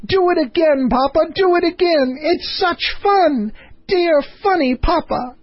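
put-on very high, delighted child's calling out; gleeful cry. Princess dancing round her angry father, high and gleeful. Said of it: "clapping her hands and crying".

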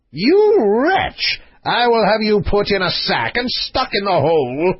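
high, outraged royal threat; furious king. Acted. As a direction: threaten him as an enraged monarch. King threatens the cool prince.

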